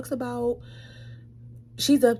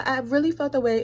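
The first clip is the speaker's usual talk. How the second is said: ordinary delivery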